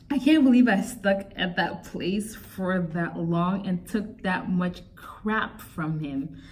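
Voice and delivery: ordinary talk, nothing marked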